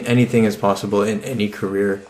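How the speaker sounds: normal talking voice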